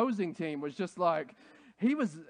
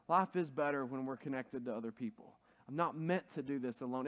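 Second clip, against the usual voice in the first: ordinary talk, nothing marked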